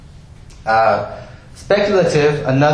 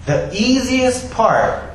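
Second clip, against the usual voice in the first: easiest drawn out slow and high; slow emphatic build on the easiest part; stress on easiest; 11 semitones higher, much slower than usual. Real, not acted. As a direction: lean hard on easiest. Preacher slows and stresses.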